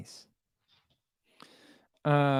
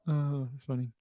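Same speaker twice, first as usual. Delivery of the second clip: normal talking voice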